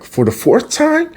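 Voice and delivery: very high squeaky question; exaggerated disbelief, pitch shooting up; mock incredulity. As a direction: act like you can't believe the plan. Acting Donnie's incredulous reply.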